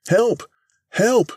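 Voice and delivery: high, loud panicked shout; desperate cry for help. A narrator's reading. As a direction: yell for help as the ship breaks apart. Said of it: "cried Mister Thurkell".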